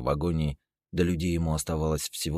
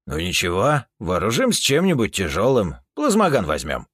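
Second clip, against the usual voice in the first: loud confident reply; 4 semitones higher, 8 dB louder than usual. Acted. Character voice in argument.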